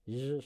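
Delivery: low, soft, buzzing "zh" for জ; isolated জ sound, softly buzzed; sound demonstration. Shown as a demonstration. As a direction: hold জ as a long voiced "zhhh". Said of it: "জ সাউন্ডটা".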